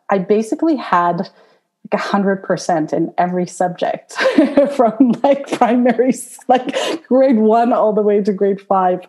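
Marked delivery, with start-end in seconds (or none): 4.2-4.7 short high laugh burst; sheepish giggle; embarrassed laugh. Real, not acted. Embarrassed laugh at own grades. 4.7-9.0 high, laughing through the words; embarrassed laughing speech; laughing while talking. Real, not acted.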